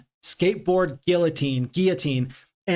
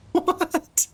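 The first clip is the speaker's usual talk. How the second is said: baffled laughing what. Real, not acted. Baffled by absurd riddle.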